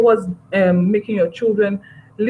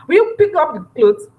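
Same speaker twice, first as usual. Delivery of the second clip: higher, louder yelled order; angry parent shouting across the house; shouted command; 8 semitones higher, 4 dB louder than usual. Shown as a demonstration. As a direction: yell it at the child, as the wrong way.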